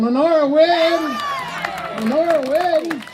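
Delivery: big game show proclamation; winner announcement. Acted. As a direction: trumpet the winner's name to the room.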